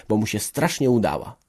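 usual speaking voice

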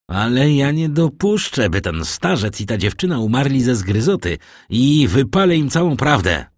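high, forceful protest; indignant outburst. A narrator's reading. Caderousse threatening Danglars with the truth, indignant. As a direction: refuse to stand by, voice rising.

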